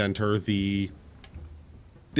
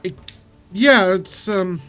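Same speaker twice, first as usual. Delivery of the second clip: higher, sympathetic innkeeper; fussing tavern keeper; 9 semitones higher, 3 dB louder than usual. Acted. GM voices the innkeep.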